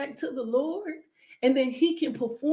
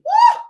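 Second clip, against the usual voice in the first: high, loud whoop; preacher's whoop; 11 dB louder than usual. Real, not acted. Preacher whooping at the congregation. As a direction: let out a joyful woo.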